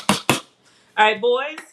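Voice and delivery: calling out across the house. Real, not acted. Calling sons to dinner.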